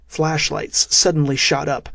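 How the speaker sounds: ordinary delivery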